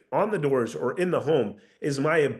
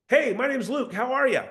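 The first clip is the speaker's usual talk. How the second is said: high, lilting "How are ya?"; unsure upward sales greeting, voice riding high; upward tonality; 9 semitones higher than usual. Shown as a demonstration. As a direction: pitch "Hey, my name's Luke" up high. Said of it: "That's an upward tonality".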